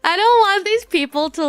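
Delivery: high, loud tearful whine; hammed-up sobbing complaint; mock sobbing. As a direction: wail it like an embarrassed crybaby.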